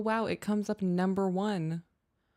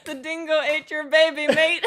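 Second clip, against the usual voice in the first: high, loud, through helpless laughter; real laughter bursting through the words; laughing; 11 semitones higher, 10 dB louder than usual. Real, not acted. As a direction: attempt an Aussie line while cracking up. Laughing through attempted Australian line.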